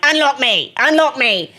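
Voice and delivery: put-on whining in a tipsy English accent from the backseat; drunk English accent. Stuck in the backseat of the car, pleading with a friend, in an English accent. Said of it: "start talking with an English accent".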